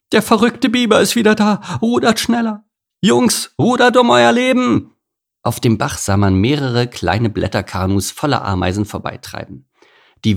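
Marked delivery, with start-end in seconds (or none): 0.1-4.9 very high, loud piping alarm; tiny creatures squealing frantic orders; squeaky panic. Acted. Ants paddle away from the crazy beaver, squeaky panic. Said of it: "ein Chor piepsiger Stimmen".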